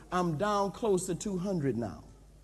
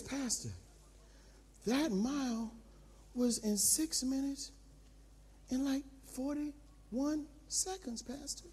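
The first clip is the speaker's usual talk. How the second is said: much softer, high trainer voice; soft report; 5 semitones higher, 12 dB quieter than usual. Retold or imitated. Trainer telling pastor his mile time, soft and high. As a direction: tell it quietly, impressed.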